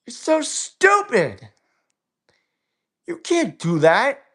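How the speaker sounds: high-pitched exasperated disgust; real outraged scolding. Real, not acted. Outraged rant continues.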